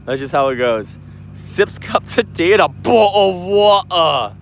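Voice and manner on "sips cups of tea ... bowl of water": slow, hammed-up British accent; put-on British tea-drinker accent; mock British accent. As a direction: send up a British accent, drawn out. Mocking a British commenter's speech.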